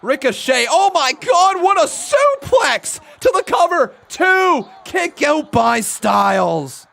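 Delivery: high, shouted near-fall call; live play-by-play. Real, not acted. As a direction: erupt over the big move, stretching the key words.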